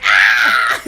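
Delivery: loud low startled "ah"; a sudden hammed-up yelp; imitating her cry as she falls in; mock scream. Girlfriend falls through ice.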